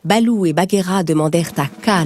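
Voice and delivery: usual speaking voice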